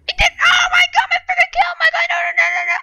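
high, shrieking gamer outrage; screeching no, no, no; frustrated shriek. Real, not acted. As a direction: wail about the stolen kill. Missed his kill in game.

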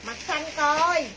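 slow, loud call across the room. Real, not acted. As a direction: stretch it out and call. Seller calling across the room.

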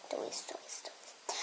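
breathy unvoiced whisper of the word. Real, not acted. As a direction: whisper it softly to yourself. Kid repeating word, whispered.

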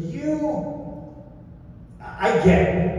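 high, soft, drawn-out "you", then a pause; hesitant verdict, stalling after the first word; retold puzzlement. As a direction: size someone up doubtfully before answering. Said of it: "looks at me and he goes".

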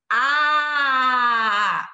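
A sung out loud and open, mouth wide; open A demo. Shown as a demonstration. Teacher showing the open A loudly. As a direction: belt an open A. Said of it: "E é um A bem aberto".

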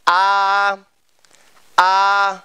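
"a" held long and even for repeating; slow repeat-after-me "a"; model vowel. Shown as a demonstration. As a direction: sustain "a" on one steady pitch. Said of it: "Các bạn đọc theo thầy".

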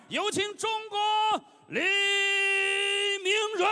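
very high shouted name callout; boxing ring announcer. Real, not acted. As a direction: roar the fighter's name to the arena. Ring announcer introduces red fighter.

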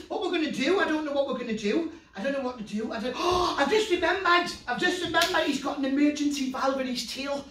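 ordinary talk, nothing marked

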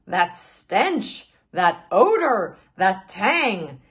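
disgusted. Acted. Glider disgusted by smell.

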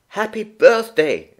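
put-on a small boy greeting the birthday child; little boy's greeting. A narrator's reading. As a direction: chirp it like a small boy at a party. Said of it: "Alfie remembered to say".

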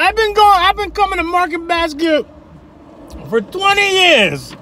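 high, loud whiny complainer voice; mocking angry customer. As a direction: squeal it like an outraged regular. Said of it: "He's like".

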